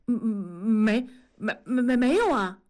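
stammering denial, voice jumping up; flustered stammer. Acted. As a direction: caught out and tripping over every word. Caught out over borrowed money. (慌张结巴地否认)

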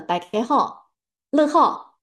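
乐好 said the Teochew way, not Mandarin 你好; Teochew dialect. Shown as a demonstration. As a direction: say 大家好，乐好 in Teochew, bright rising 乐好. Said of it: "打招呼的时候会说".

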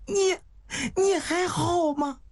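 high, tearful worry; anxious, near tears. Acted. Woman checking on the bitten man, high and tearful. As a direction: falter with fear for him.

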